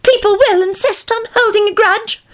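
put-on high, thin old woman's voice. A narrator's reading. Old woman at the table, high frail voice. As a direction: lift it into a frail crone.

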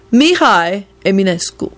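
accented, put-on voice of a reserved scholar; abrupt announcement. A narrator's reading. Radu pulling a tome from his shelves, abruptly. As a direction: announce a poet's name out of nowhere. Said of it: "he announced abruptly".

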